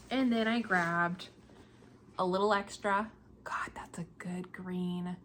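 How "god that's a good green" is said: slow, voiceless whisper of admiration; awed whisper. Real, not acted. Knitter gazing at her green yarn, awed whisper. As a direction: breathe it as if the green stuns you.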